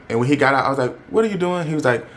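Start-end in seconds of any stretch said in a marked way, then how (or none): 1.1-1.7 alarmed question. Retold or imitated. Retelling her alarmed question to him.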